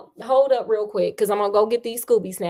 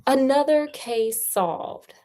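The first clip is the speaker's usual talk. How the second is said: drawn out, slow closing line; slow catchphrase; 4 semitones higher, much slower than usual. Acted. Host ending the story, said slowly like a sign-off. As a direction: stretch each word like a show sign-off.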